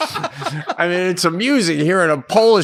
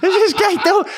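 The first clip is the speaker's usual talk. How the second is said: laughing incredulous rant; laughing outrage. Real, not acted. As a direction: shout it out while cracking up. Retold outrage while laughing.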